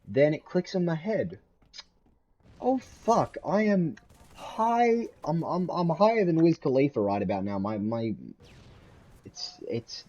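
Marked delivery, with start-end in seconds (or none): 2.6-7.0 sudden realisation. Retold or imitated. Realising mid-dinner he is high.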